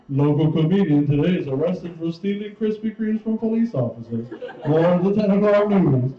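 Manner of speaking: lightly done anchor read; hint of a TV anchor; mock newscaster. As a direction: announce it like the ten o'clock news. Mock news anchor headline.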